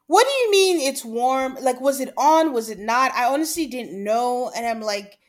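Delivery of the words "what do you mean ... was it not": raised, louder, exasperated questions; indignant and incredulous; exasperated questioning. Acted. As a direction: demand an explanation, annoyed. Acting out annoyance at his answer.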